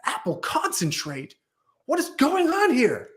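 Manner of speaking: hammed-up celebrity chef appalled at the answer; mock Ramsay disbelief. Gordon Ramsay hearing about the apple concentrate, in outraged disbelief. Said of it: "laying into these guys".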